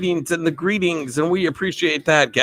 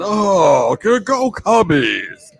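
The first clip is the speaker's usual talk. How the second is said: real Harry Carey; much slower than usual. Retold or imitated. Streamer demos the real version, slow ballpark cheer. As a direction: drag out the Cubbies cheer. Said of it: "the real one like he actually sounded".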